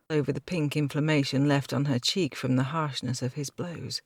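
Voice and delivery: ordinary delivery, nothing marked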